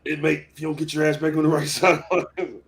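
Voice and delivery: barking prison guard. Retold or imitated. Acting the guard yelling at him.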